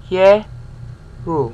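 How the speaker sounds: "Ye" then "ru", two distinct beats; two syllables. Shown as a demonstration. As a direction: run it as just two syllables. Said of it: "it's just two".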